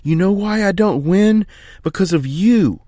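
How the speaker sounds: screeching. Acted. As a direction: scream it at your father, finally breaking. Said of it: "Philly screeched".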